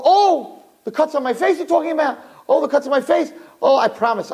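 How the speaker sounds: high, whiny play-innocent voice; feigned innocence. Acted. Husband playing dumb to his angry wife, high and whiny. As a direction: act shocked and innocent.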